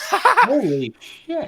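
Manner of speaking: slow, high laughing "holy shit"; laughing disbelief. Real, not acted. Player reacting to the monster's exact saving roll, laughing in disbelief.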